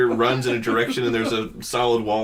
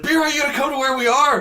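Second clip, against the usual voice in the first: calling friend; 3 dB louder, faster than usual. Retold or imitated. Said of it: "we're just like".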